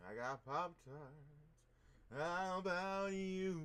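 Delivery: slow, loud, high singsong brag; teasing boast. Acted. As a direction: drag it out to tease them. Teasing boast about snacks.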